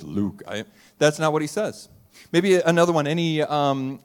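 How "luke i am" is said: lightly lowered mock Darth Vader; a hint of the dark villain intoning. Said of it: "you say it in that voice".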